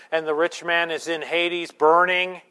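loud, driving sermon delivery; preaching at full force. Acted. Preaches rich man story forcefully.

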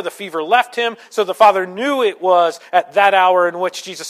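loud, ringing preacher's read of the verse; scripture reading. Acted. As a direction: proclaim the text to the whole hall. Reads scripture aloud forcefully.

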